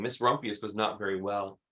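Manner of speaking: plain reading voice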